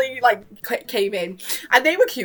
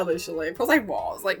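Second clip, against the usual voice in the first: ordinary delivery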